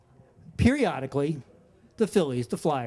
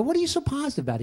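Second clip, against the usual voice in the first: much higher incredulous question; puzzled; 9 semitones higher, much faster than usual. Retold or imitated. Asking his always-upbeat friend why he's so cheerful, incredulous. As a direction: ask in disbelief.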